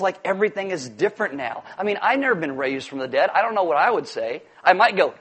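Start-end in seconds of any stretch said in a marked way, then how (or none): none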